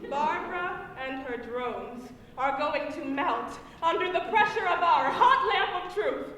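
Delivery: high, emphatic stage delivery; dramatic monologue. Acted. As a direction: play it big for a laughing audience. Performs a comic monologue onstage.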